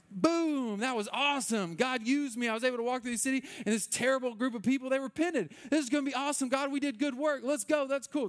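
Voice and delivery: high-pitched, mock-triumphant celebration; imagined elation. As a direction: celebrate like you just won, pitched well up.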